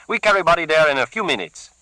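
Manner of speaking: a keen henchman keen to use the cleaver; Carlos, eager thug. Acted. Carlos offering his boss a fix, eager.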